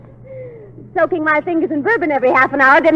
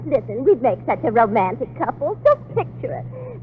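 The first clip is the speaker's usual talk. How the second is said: sultry hush; 3 semitones lower, 6 dB quieter than usual. Acted. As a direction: lure him in softly. Romantic seduction, soft and slow.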